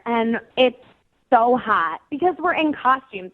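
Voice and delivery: normal talking voice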